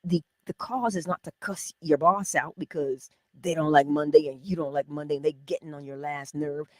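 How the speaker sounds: ordinary delivery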